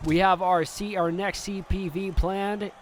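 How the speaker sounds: ordinary talk, nothing marked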